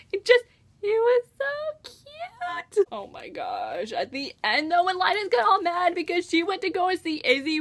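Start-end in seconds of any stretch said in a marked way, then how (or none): 0.1-2.9 high, drawn-out squeal at something adorable; gushing. Real, not acted. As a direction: melt into a very high, stretched squeal. Gushing over the kiss scene.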